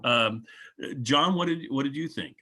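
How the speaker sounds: usual speaking voice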